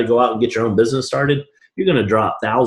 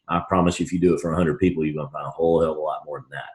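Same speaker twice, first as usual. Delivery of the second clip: quiet aside; 5 semitones lower, 5 dB quieter, faster than usual. Real, not acted. Drops to confiding aside.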